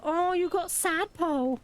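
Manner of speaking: high, slow, sweet little voice; cute character voice. Acted. Streamer voicing the game character, sugary and slow. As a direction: coo it over the sad tadpole.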